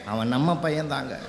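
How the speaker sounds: low, soft retold reply; subdued confession. Wife confessing to husband the boy is theirs, subdued. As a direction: let it drop low, imitating the wife.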